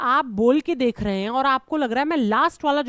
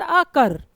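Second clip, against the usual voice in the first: अकर with short अ and punched कर; stress on second syllable; 4 semitones higher, 4 dB louder, much slower than usual. Shown as a demonstration. Teacher showing more stress on the later part of अकर, कर emphasised. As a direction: lean hard on कर in अकर. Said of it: "बाद वाले पर ज्यादा stress दिया गया".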